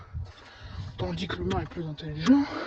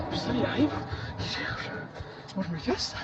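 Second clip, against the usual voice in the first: hushed nervous whisper; 9 dB quieter than usual. Real, not acted. Hiding from pursuer in game.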